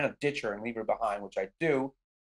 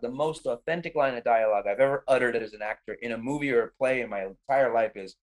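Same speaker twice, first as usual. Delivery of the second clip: plain reading voice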